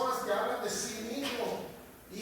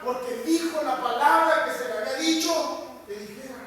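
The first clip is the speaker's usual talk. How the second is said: loud, driving pulpit preaching; preaching at full force; 5 semitones higher, 9 dB louder than usual. Acted. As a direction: thunder the miracle out to the congregation.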